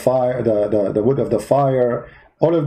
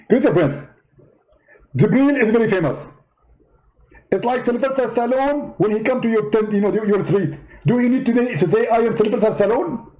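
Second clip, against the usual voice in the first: high, indignant preacher voice; Zakir Naik impression; 7 semitones higher than usual. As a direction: play the offended debater, pitched well up. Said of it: "Zakir Naik?".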